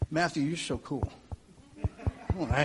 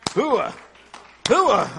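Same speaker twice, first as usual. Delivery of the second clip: hooah shouted high and loud; loud gung-ho soldier; 7 semitones higher, 8 dB louder than usual. Acted. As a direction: bark out hooah like a drill sergeant. Military call and response.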